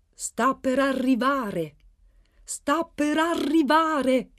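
loud, high and drawn-out excited cry; annuncio eccitato. A narrator's reading. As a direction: proclaim the arrival in great excitement, stretching it out. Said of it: "Aveva un tono eccitato". (grido eccitato, alto e scandito)

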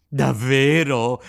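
slow, loud, delighted cry; joyful cry. Acted. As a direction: cry out with joy. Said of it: "gridò Pinocchio saltando dall'allegrezza". (grido di gioia, lento e forte)

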